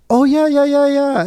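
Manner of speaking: high, quick run of eager yeahs; mimicking the restaurant woman. Restaurant cashier chatting up a cash-flashing customer, high eager yeahs. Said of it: "And she's like".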